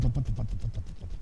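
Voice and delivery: low, fading thump-thump of a flat tire; imitating tire thumps. Preacher to congregation, imitating a flat tire's low fading thumps. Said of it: "go thump".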